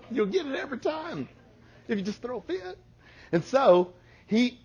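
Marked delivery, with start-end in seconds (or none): 0.1-2.8 put-on higher, fast, softer kid voice; little boy confiding a trick; kid's sly tip. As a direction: whisper the secret like a kid.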